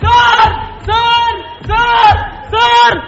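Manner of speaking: calling out. Acted. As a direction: shout for the boss to come. Calling the boss.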